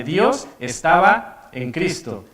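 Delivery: slow, weighty "Dios estaba en Cristo" with a falling "Dios"; emphasis on Dios. Shown as a demonstration. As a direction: lean on "Dios" and let each word land slowly. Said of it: "Hago énfasis en la primera parte".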